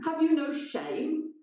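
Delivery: high, indignant; lightly voiced angry intruder; indignant newcomer. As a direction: claim it as yours. Newcomer accuses.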